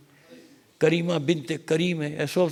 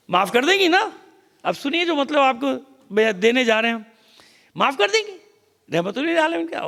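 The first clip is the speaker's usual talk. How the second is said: high, loud sneering taunt; a scornful mock of their plan; 8 semitones higher, 4 dB louder than usual. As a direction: sneer the plotters' words up high. Plotters mock Fatima's mercy.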